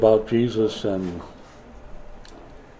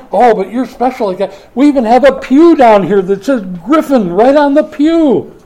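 gushing church folk; 10 semitones higher, 8 dB louder than usual. Retold or imitated. Church members fussing over the preacher.

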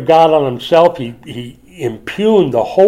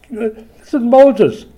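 ordinary talk, nothing marked

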